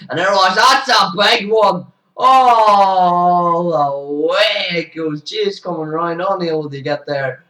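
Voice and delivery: loud, high, slow drawn-out call of a big hit; real thrill, stretching the words; excited call. Real, not acted. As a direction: let it soar with the ball. Calling a big six.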